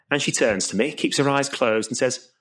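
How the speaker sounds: ordinary talk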